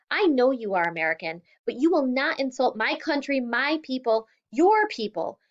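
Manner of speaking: a father's wounded, angry yell; angry shout. Acted. Father shouting back at daughter.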